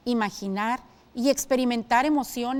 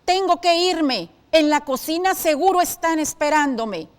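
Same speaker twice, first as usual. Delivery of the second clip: angry; 5 semitones higher, 7 dB louder than usual. Shown as a demonstration. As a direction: snap it out in anger at the household staff. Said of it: "entre paréntesis dice con enojo".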